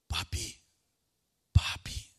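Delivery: hushed, breathy little call of "papi"; child calling dad. Retold or imitated. Son tapping his praying father's shoulder, hushed child's call. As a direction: imitate a small child wanting attention.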